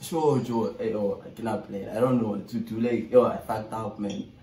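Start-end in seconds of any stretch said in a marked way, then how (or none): none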